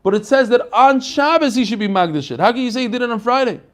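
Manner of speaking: usual speaking voice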